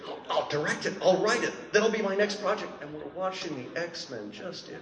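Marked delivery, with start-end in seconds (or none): 0.1-2.7 eager Cameron. Retold or imitated. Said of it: "and Cameron's saying".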